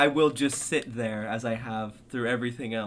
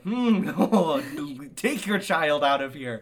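high, loud mock-exasperated outburst; hammed-up exasperation; exasperated groan; 3 semitones higher, 6 dB louder than usual. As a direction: groan in pained annoyance at a noisy child. His exasperated reaction in theater, retold.

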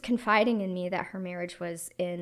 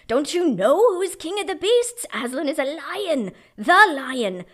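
louder, higher, booming Beaver; stern Beaver; 7 semitones higher, 10 dB louder than usual. Acted. Mister Beaver scolding the children, stern and booming. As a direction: proclaim it like a proud beaver. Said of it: "said Mister Beaver sternly".

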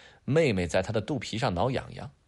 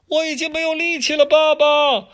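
put-on very high, feeble child's voice; too tired to climb; worn-out kid; 9 dB louder than usual. Adam out of strength in the drain, high tired child.

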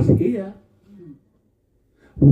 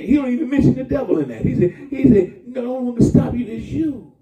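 excited; 8 semitones higher, faster than usual. Acted. Preacher voicing Jesus, excited.

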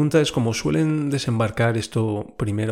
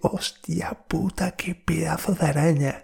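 shocked curse; 5 semitones higher than usual. Retold or imitated. Watching the spider run, a high stunned swear. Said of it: "Y yo".

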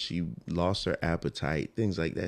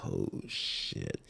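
hushed, very low disbelief; retold shock, quiet and deep; stunned realization; 12 semitones lower, 7 dB quieter than usual. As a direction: let it drop to a low, stunned near-whisper. Retold shock at baby girl news.

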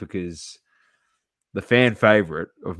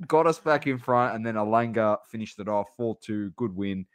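ordinary talk, nothing marked

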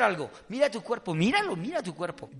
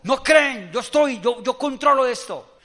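loud, raised rebuke; stern exhortation; 5 semitones higher, 7 dB louder, slower than usual. Acted. Jesus exhorting his disciples after the storm, stern and loud. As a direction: assert total authority over doubters. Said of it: "los exhortó y les dijo".

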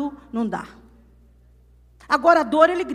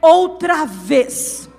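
loud, long-held words of a fiery Pentecostal proclamation; preach at full force; 2 semitones higher, 8 dB louder than usual. Real, not acted. Preacher declaring God will do it for the congregation, full-force preaching. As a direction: proclaim the promise with building power.

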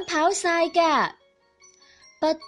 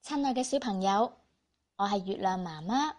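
put-on reading to children; 2 semitones lower, 6 dB quieter than usual. Storyteller greets children softly.

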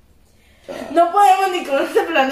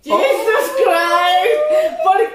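exasperated outburst; 7 semitones higher, 4 dB louder, slower than usual. Real, not acted.

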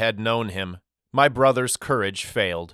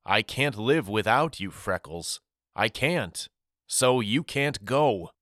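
usual speaking voice

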